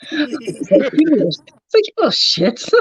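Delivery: squeaky high laughing mock outrage; high laughing outburst. Real, not acted. Laughing at the nephews, high amused mock outrage. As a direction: laugh the insult out high and tickled.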